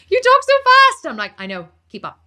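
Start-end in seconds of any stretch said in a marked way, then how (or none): 0.1-1.1 high, loud mock exclamation; mimicked complaint. People always tell her this, mimicked high and loud. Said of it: "people always say to me". 1.4-2.2 offhand comeback. Retold or imitated. Deadpan comeback to the complaint.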